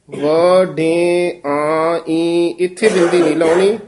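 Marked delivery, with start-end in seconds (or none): 0.1-2.0 ਵ, ਡੇ, ਆ, spelled out one sound at a time; syllable by syllable. Shown as a demonstration. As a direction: break ਵ, ਡੇ, ਆ, into separate beats. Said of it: "ਬਿੰਦੀ ਲਾਉਣੀ ਹੈ".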